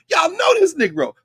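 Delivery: rally the crowd, you know him; high excited call-out to listeners; excited exclamation. Real, not acted.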